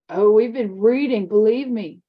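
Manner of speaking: lightly voiced sneering older boy; mocking bully. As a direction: lean on it with a sneer. Bully's slow reply.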